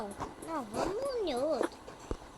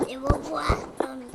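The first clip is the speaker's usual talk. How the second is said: ordinary delivery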